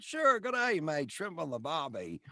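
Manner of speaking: hammed-up Aussie tourist cliché; a quick jokey Australian accent. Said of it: "You do Australian?".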